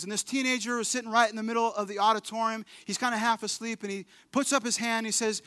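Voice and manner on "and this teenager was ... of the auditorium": ordinary talk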